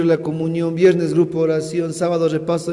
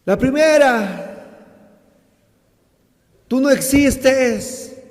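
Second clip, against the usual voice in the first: slow, high, loud complaint; raised voice; 5 semitones higher, 3 dB louder than usual. Retold or imitated. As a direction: drag it out as a plea. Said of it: "levantó la voz".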